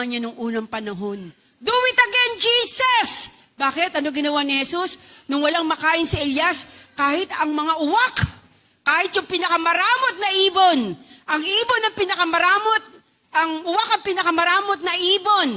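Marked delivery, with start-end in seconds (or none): 1.6-3.3 soaring shout to Jesus; loud cry. Real, not acted. Preacher crying out to Jesus in a loud shout. 5.3-15.5 rising, louder preaching. Real, not acted. Preacher stirring the congregation, building louder.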